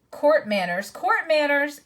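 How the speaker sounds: high mocking sneer. A narrator's reading. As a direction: sneer it with scorn. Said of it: "sneered Knickerbrick".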